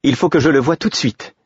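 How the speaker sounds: high, eager rush of joy; can't wait a moment longer; excited exclamation. A narrator's reading. The queen, overjoyed, insisting on seeing the garden now. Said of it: "s'exclama-t-elle".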